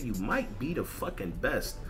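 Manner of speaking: ordinary talk, nothing marked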